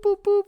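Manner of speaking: high mouth beeps like a car sensor; beeping alarm imitation. High beeps mimicking the blind spot sensor for the audience. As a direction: squeak out the warning beeps, high.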